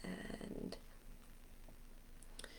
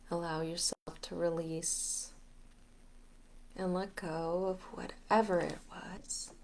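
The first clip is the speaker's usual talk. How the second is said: guided meditation; 6 dB quieter than usual. Acted. Leader guiding listeners to release and get grounded, guided meditation. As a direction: talk them gently through letting go, unhurried.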